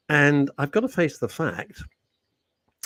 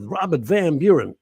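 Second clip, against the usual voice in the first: loud, high booming introduction of the name; a loud, showy presenter's announcement; grand announcer name; 4 semitones higher, 5 dB louder than usual. Pretend. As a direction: boom out the name like a grand herald. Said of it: "He doesn't talk like that at all".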